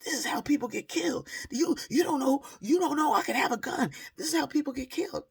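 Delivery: high, loud imitation of her panicked threats; mock frantic woman. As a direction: shout it high while running off. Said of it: "she was saying".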